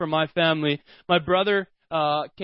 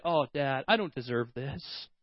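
softer, mock-humble little girl; 5 semitones lower, 8 dB quieter than usual. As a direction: pretend to refuse the gift meekly. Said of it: "It's not like she's".

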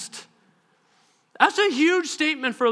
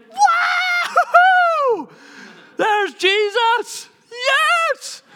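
high, loud, drawn-out whoop; ecstatic cheer; 5 dB louder, much slower than usual. Acted. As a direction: cheer like you've spotted your hero. Unborn John ecstatic at seeing Jesus.

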